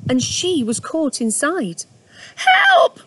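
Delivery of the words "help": shrill, loud frightened cry much higher; a trapped mother rabbit crying out; panicked cry for help. Acted. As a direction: yelp for help, trapped and scared. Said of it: "hopping about anxiously".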